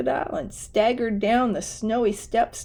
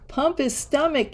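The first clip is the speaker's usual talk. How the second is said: a hammed-up howl of alarm; yowled; 7 semitones higher than usual. A narrator's reading. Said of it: "yowled Elwood".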